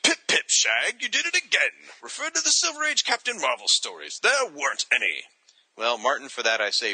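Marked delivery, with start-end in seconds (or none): 0.0-5.2 higher-pitched mock British accent; hammed-up British reading of a letter. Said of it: "reading his letter in a British accent".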